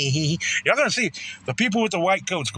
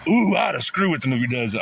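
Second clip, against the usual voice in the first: ordinary delivery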